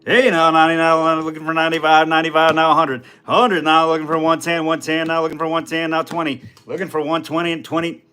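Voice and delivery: fast, loud auctioneer chant. Pretend. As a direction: rattle off bids like an auctioneer. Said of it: "Fast like an auctioneer".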